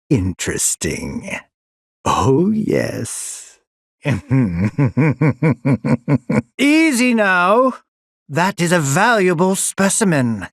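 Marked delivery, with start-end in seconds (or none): 4.0-6.5 gleeful laugh. Acted. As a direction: laugh with delight at the specimen. Villain laughs at specimen.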